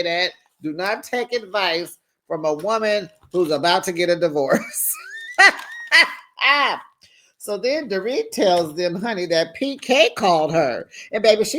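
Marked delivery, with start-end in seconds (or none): 5.0-6.1 shrieking high laugh; high cackle. Real, not acted. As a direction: burst into a squealing cackle. Reacting to divorce joke.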